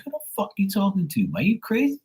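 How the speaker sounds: high-pitched, quick mock outrage; incredulous retold challenge, pitched high; retold indignant scolding. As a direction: act out telling off a rude nephew, voice jumping up. Retold telling-off of nephew.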